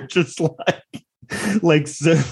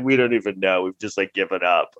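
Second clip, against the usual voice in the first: lightly voiced resignation, sinking lower; defeated lawyers; 7 semitones lower than usual. Losing side's lawyers throwing in the towel, mildly defeated. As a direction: let it drop away as if giving up. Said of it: "is just like".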